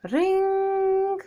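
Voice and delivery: high bright imitated phone ring. A narrator's reading. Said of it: "Suddenly the phone rang".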